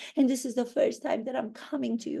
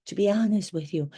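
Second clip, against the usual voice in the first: usual speaking voice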